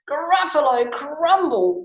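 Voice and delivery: high, loud, drawn-out cry; stretched storybook alarm; horrified Gruffalo. Acted. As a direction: wail it slowly in mock horror. Gruffalo terrified of being eaten.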